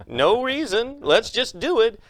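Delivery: flippant mock. Mocking magic's absurdity.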